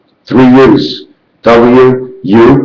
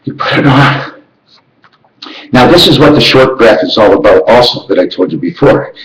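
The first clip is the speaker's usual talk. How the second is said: ordinary delivery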